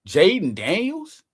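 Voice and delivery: slow rising disbelief; can you believe it?; stretched, amazed question; incredulous. Real, not acted.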